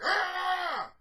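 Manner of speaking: sudden high yell of frustration; frustrated scream. Real, not acted. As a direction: let out a fed-up scream. Frustrated outburst at tug of war.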